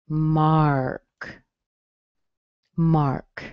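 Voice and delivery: slow falling "Mark." with an open ah; teacher's careful "Mark."; model pronunciation. Shown as a demonstration. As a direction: repeat "Mark." gently. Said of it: "does have an ah sound".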